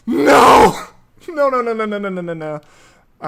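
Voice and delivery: high, wailing string of no's; panic, then fade to dread; panicked no. Real, not acted. Pokemon about to faint.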